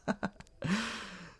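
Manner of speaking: a high airy chuckle; amused laugh; light laugh. Acted. Laughing at his own joke.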